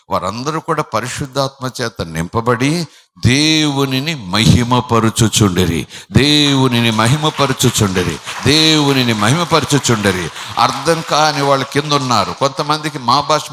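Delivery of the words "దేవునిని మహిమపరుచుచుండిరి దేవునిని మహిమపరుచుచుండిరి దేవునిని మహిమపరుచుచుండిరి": louder, slow repeated refrain; full force preaching. Acted. Preacher to congregation, repeating the praise line loudly. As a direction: hammer the refrain home.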